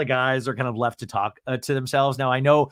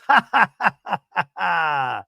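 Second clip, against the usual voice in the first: high, put-on staccato cackle; imitated laughter; 4 semitones higher, 3 dB louder than usual. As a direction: mimic someone laughing hard at this. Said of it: "Ryan's laughing at this".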